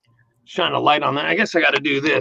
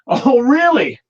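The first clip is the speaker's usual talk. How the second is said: high, scornful oh really; mocking, unconvinced retort; sarcastic disbelief; 8 semitones higher, 4 dB louder, slower than usual. Acted scene, sarcastic reply.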